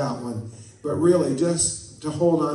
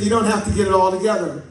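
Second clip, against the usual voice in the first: louder, quicker, higher call to the neighbor; said to your neighbor; 4 semitones higher, 5 dB louder, faster than usual. Shown as a demonstration. Preacher has the church tell a neighbor, a louder call. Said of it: "Look at your neighbor and say".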